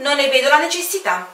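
quick, light refusal with a smile; breezy smiling refusal; smiling aviator. Acted. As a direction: brush it off with a grin. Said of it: "disse l'aviatore sorridendo".

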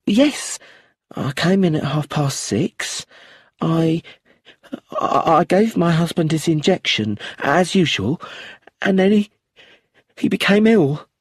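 frightened, tearful widow. Acted. Widow retelling the death to the inspector, high and panicked. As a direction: rush through it on the edge of tears. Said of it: "she looked frightened".

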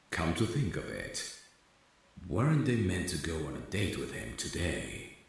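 ordinary delivery, nothing marked

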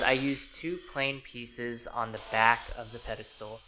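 ordinary delivery

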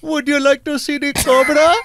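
high Indian-accented pickup line; sexy Indian accent. Shown as a demonstration. Performing dirty talk on request, Indian accent. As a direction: offer it suggestively in an Indian accent. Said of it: "sexy talk in an Indian accent".

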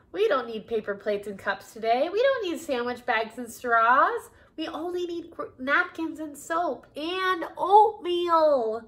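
a hint of Bert setting Ernie straight; mildly fussy puppet voice.

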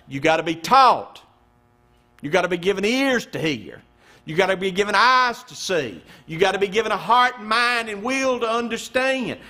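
rising preacher's refrain, hitting each key word; fervent repeated pulpit cadence; preaching at full force. Real, not acted. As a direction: drive each line home from the pulpit.

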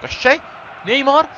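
loud, high rising cheers; excited play-by-play. Real, not acted. Gamer cheering his attack on and urging Suarez to shoot, loud and excited. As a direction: urge the striker to shoot. Attack building toward goal.

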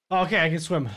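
ordinary talk, nothing marked

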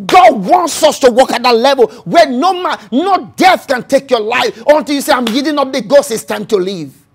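loud, rising preacher's cadence; preaching at full force. Acted. Preacher retelling Jesus' arrest to the church, full force. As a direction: preach it out to the congregation.

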